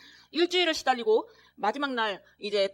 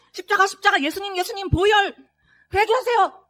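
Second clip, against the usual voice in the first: high, loud, pressing plea; loud urgent urging; 10 semitones higher, 10 dB louder than usual. Retold or imitated. Son urging his dying father in the ICU, loud urgent plea. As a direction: call into the father's ear, urgently. Pouring words into father's ear.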